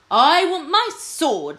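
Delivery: slow, high, loud royal snap; an angry king snapping an order at his lords. Acted. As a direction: bite off each word at them. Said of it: "snapped King Fred".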